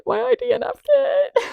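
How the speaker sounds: high-pitched squeaky admission; squeaky, mock-pained confession; comic squeal. Real, not acted. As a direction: let it climb into a high, strained squeak. Comic aside about quitting.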